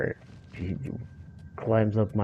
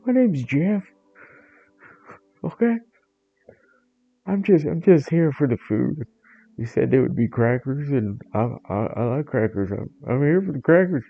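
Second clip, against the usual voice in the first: high, loud simple-minded cat; squeaky food-obsessed dimwit begging; dopey cat Jeff; 6 semitones higher, 5 dB louder than usual. Acted. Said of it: "And the other one".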